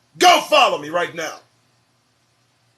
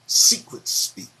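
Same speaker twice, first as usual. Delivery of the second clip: slow, lower mock whisper; mock secretive whisper; 7 semitones lower, slower than usual. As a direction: hush it like a secret code word, slowly. Repeats the term, hushed.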